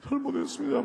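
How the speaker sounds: high mock wail of repentance; dying groan. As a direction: plead like a man dying. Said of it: "막 죽는 소리를 해". (죽는 소리로 흉내)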